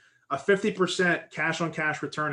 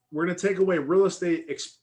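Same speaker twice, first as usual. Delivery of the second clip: ordinary talk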